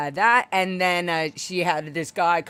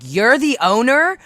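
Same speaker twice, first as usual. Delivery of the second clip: loud, higher, slow confrontation; a raised voice challenging the owner; confronting shout; 8 semitones higher, 9 dB louder, slower than usual. Retold or imitated. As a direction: call her out in front of the diners. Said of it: "I argued with her in front of everybody".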